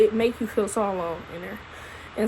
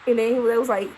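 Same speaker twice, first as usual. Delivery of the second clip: normal talking voice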